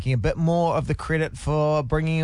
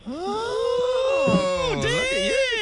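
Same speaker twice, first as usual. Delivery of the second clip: excited teasing; much slower than usual. Real, not acted. Teasing caller's fancy tip.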